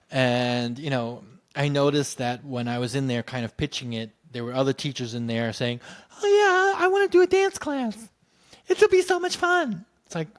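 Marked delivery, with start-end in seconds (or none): 6.2-9.8 high, loud sing-song imitation of a gushing colleague; mock-excited teacher voice, high and louder; mocking gushy teacher. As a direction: parody their breezy enthusiasm, pitched way up.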